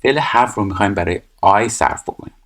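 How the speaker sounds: normal talking voice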